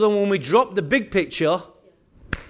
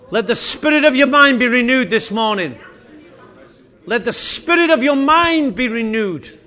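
loud, raised-pitch pleading that swells to a peak; fervent prayer; 4 semitones higher, 8 dB louder than usual. Real, not acted. Pastor asking the Father to bring the people in, fervent prayer. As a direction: cry out to God in forceful bursts, then ease off.

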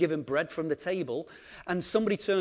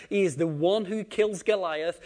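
ordinary delivery

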